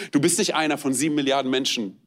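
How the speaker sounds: ordinary delivery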